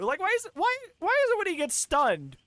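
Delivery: high, insistent exasperation; exasperated rant. Real, not acted. Streamer asking why the killer does this, exasperated. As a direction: press the question with pitch way up.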